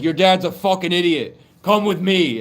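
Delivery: high, loud, scolding recruitment; forceful shouted command. Retold or imitated. Ancestor recruiting the boomers' kids, shouting forcefully. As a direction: order them along at a yell.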